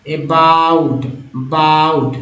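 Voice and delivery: अबाउट drawn out slowly, the बाउट stretched; teacher's stretched-out अबाउट; word sounded out. Shown as a demonstration. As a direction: slowly model अबाउट, then बाउट alone with a long vowel. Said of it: "पढ़ते हैं".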